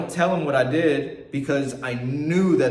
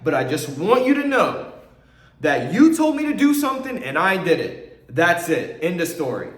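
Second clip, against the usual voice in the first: higher and louder, rising to a cry then settling firm; impassioned declaration of faith; 5 semitones higher, 5 dB louder than usual. Retold or imitated. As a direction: proclaim it to God, peaking on told me. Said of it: "it was a declaration of my faith".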